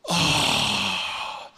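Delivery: long airy gasp of relief; acted relieved breath. Lying in bed, able to breathe at last, acted relieved gasp. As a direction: let out a big breathy "oh" as air returns.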